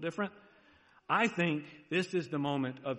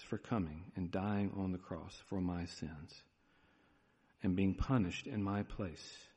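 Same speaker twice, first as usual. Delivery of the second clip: leading a prayer; 5 semitones lower, 3 dB quieter than usual. Acted. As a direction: pray it humbly for the listeners to echo. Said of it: "I encourage you to pray these words".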